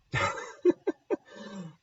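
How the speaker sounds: high amused laugh bursting out; burst of laughter. Real, not acted. Reacts to Babe Ruth guess.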